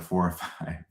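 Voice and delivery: ordinary delivery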